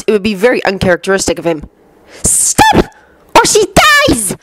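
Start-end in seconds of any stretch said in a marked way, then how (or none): 2.2-4.3 very high shrieked threat. A narrator's reading. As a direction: shriek it like a villainess. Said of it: "Bellatrix's high voice shrieked".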